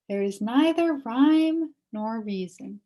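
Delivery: ordinary delivery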